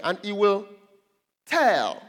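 "tell" held back by a pause, then loud; emphatic scripture reading. Real, not acted. Preacher picking out the key verb of a verse, emphatic. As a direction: hang on "will", land hard on "tell".

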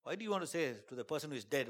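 ordinary delivery

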